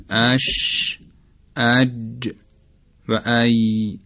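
"اش، اج و ای" with mid-tongue near palate; isolated sounds, each final consonant clearly held in "اش، اج و ای"; demonstrating palatal letters. Shown as a demonstration. Teacher showing shin, jim and ye, isolated "اش، اج و ای". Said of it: "یا نزدیک شدن میانهٔ زبان به کام".

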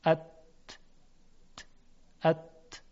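ات with a voiceless ت, throat still; voiceless t demo. Shown as a demonstration. Teacher sounding ات so students feel no vibration. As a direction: say ات without voicing. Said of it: "حالا ت رو تلفظ کنید".

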